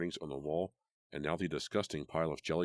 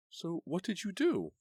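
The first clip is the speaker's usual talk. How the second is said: high, slow urgent question; anxious; 14 semitones higher, 3 dB louder, slower than usual. A narrator's reading. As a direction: press for the rest. Karen anxiously asking.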